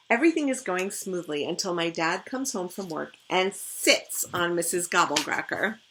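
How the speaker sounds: plain reading voice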